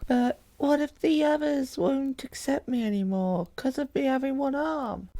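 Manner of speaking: put-on high, soft, worried boy voice; a nervous kid fretting over his missing arm; voiced young boy. Injured boy asking his friends, worried and small.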